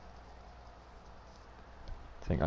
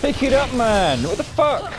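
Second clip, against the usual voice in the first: high, loud frustrated yell; frustrated shouting; 9 dB louder than usual. Real, not acted. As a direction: yell at your teammate. Teammate failed revive.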